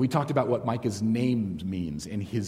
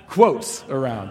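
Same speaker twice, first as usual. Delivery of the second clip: laughing through the words; amused laugh on the phrase; 2 semitones higher, 7 dB louder, slower than usual. Real, not acted. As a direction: chuckle it out, amused at the slip. Laughs while correcting himself.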